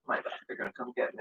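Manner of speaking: fast, panicked whisper; scared whisper. Real, not acted. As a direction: whisper it in fear.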